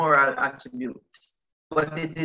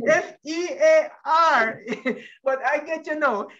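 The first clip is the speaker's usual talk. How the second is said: laughing while spelling it out, higher voice; high, laughing confession; amused at own mistake; 8 semitones higher than usual. Real, not acted. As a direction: let real giggles break up the letters. Laughing at own misunderstanding of word.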